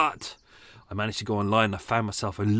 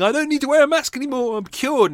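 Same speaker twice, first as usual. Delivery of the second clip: much higher, louder mock-dumb voice; mocking maskless idiot; 7 dB louder than usual. Mocking maskless people, much higher loud mock-dumb voice. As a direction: play the clueless idiot to the hilt. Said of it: "dickheads are walking around with no masks going".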